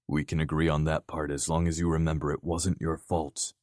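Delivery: ordinary delivery, nothing marked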